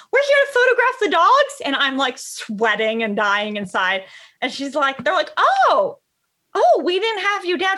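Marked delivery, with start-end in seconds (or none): 0.1-1.6 much higher, louder, breezy confidence; bold cheerful claim. Retold or imitated. Bold friend quoted at hotel. 5.4-7.8 higher, louder flustered oh; mock surprised staff. Hotel staff voiced, caught off guard.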